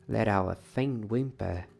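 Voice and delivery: ordinary delivery, nothing marked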